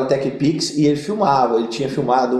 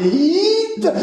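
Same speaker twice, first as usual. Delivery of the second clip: startled exclaim. Retold or imitated. Called up to the altar.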